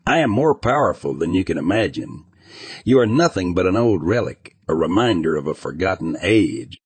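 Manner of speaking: usual speaking voice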